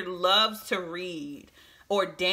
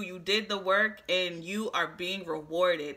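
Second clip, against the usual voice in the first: ordinary talk, nothing marked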